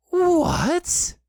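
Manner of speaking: high, loud incredulous cry; startled yelp of disbelief; astonished. A narrator's reading. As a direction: exclaim in utter bafflement. Christopher Robin baffled by Piglet's gabble.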